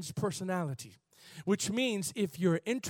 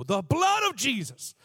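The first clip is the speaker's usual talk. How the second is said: loud, slow, raised declaration; sudden boldness; 6 semitones higher, 8 dB louder than usual. Retold or imitated. Young believer rebuking a demon, bold and loud. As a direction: proclaim it boldly, loud and drawn out. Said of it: "this boldness came on me".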